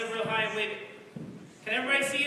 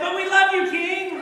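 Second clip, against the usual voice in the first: pleading crowd; 5 semitones higher, 6 dB louder than usual. Acted.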